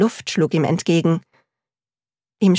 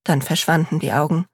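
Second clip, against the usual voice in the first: plain reading voice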